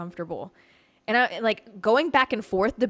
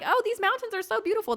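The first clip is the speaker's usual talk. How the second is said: naive gushing; 13 semitones higher than usual. Retold or imitated. Quoting her naive self.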